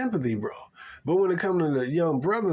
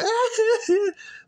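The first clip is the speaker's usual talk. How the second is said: shrill burst of laughter at them; high mocking laugh; 4 dB louder than usual. Real, not acted. Mocking the crying women.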